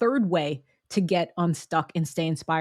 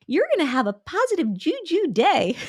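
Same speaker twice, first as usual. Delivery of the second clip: much higher, sing-song gushing; mock sugary cheer; 10 semitones higher, 3 dB louder than usual. Teasing over-the-top affirmations, playfully gushing higher. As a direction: gush it like over-sweet greeting-card positivity. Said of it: "It doesn't have to be all unicorn sprinkled".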